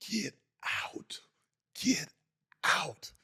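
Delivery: slow whisper with gaps between words; mouthed, unheard words. Retold or imitated. Teammate pointing while player can't hear, slow mouthed whisper. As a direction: imitate barely audible shouting, as if muted. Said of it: "and I can't hear a word".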